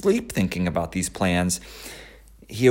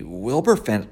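plain reading voice

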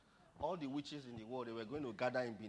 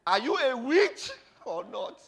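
high, loud, drawn-out challenge; slow confrontational shout; shouted interrogation; 11 semitones higher, 15 dB louder, slower than usual. Acted. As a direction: demand an answer, slow and loud. Papa's question repeated louder.

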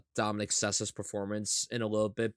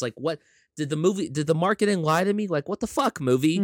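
high, loud baffled protest; hammed-up indignant disbelief; mock outrage; 7 semitones higher, 4 dB louder than usual. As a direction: act betrayed and incredulous at the movie. Acts out baffled outrage at movie.

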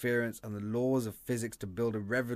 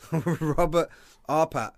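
laughing; 4 semitones higher, 6 dB louder, slower than usual. Real, not acted. Laughing at bad accent.

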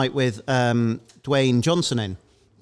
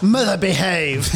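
Austin Powers voice; 4 dB louder, slower than usual. Retold or imitated. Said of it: "That's what it sounded like".

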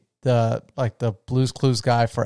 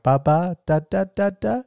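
put-on high, sing-song baby babble; playful ba-ba baby talk; baby babble imitation; 6 semitones higher than usual. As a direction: babble like an infant. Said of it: "I, like, impersonate her".

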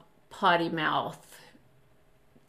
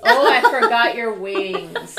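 loud, amused exclamation through laughter; amused outburst, loudly laughed; laughing realization; 3 semitones higher, 9 dB louder than usual. Real, not acted. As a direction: laugh as you say it. Laughing at child's interruption.

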